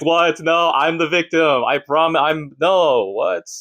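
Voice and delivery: high, stammering pretend plea; hammed-up cry of innocence; mock panicked protest. Acted protest of innocence to police.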